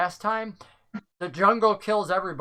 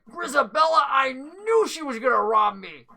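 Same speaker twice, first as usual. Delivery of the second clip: higher, louder realization; as if it finally clicks who robbed him; indignant NPC; 6 semitones higher, 4 dB louder than usual. Acted. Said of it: "He's like".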